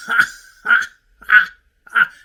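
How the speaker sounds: loud, separate ha-ha-has; loud laugh. Acted. Contestant relishing the producer's mistake, loud laughing. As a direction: burst out laughing at your own story. Laughing while telling the contract story.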